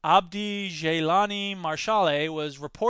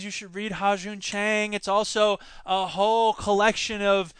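ordinary talk, nothing marked

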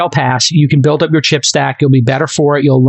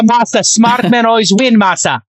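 put-on Japanese accent; 8 semitones higher than usual. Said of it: "I'm doing a Masa impersonation".

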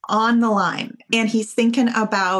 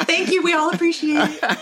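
higher-pitched, through real laughter; laughing; 8 semitones higher than usual. Real, not acted. Guest thanking the host for skipping the bad accent, laughing. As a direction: say it while cracking up.

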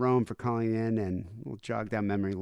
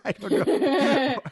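laughing; 6 dB louder, much slower than usual. Real, not acted. Laughing at his own story.

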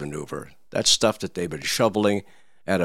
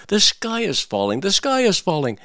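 high, louder hen alarm call; frantic hen shouting the news; panicked shout; 9 semitones higher, 3 dB louder than usual. Acted. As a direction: cry the alarm to the farmyard. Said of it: "she started shouting".

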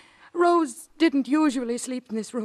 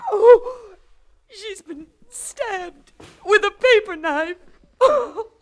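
much higher, louder, screaming in horror; horrified scream; 9 semitones higher, 6 dB louder, much slower than usual. Acted. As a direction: shriek as you find the body. Susan finds her sister stabbed.

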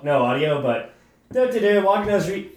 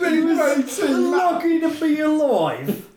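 higher, shouty mock British accent; hooligan accent; 6 semitones higher than usual. Finishing the news story for friends, in a mock hooligan accent. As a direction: belt it out in a hammed-up cockney voice. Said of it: "rate his accent".

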